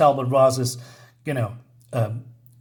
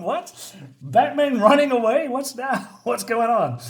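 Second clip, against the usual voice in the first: high-pitched, incredulous questioning; baffled fan, voice shooting way up; mock disbelief; 9 semitones higher than usual. Reader reacts to Batman running away, amused high-pitched bewilderment.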